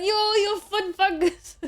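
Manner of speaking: high, drawn-out mock insult; hammed-up jeer stretched out slowly; mock pissed-off insult. Streamer rehearsing a new insult for people, hammed-up jeer. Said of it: "call people foot fungus when I'm pissed off".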